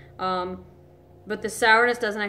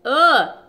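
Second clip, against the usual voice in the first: disgusted groan; 4 semitones higher, 9 dB louder than usual. Real, not acted. Reacting to sour lemon juice.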